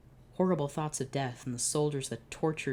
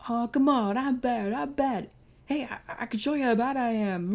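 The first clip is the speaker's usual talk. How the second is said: high, pushy insistence; pleading villain voice; 10 semitones higher, 6 dB louder than usual. Acted. Oscar's rival pleads to be judged bad, high and pushy. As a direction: convince him you're bad, pitched up.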